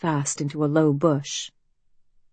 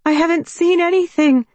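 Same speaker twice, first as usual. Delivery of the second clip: put-on higher, louder, pleading young woman; anxious young woman; 12 semitones higher, 6 dB louder than usual. A narrator's reading. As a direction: call out, nervous and hopeful. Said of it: "she calls".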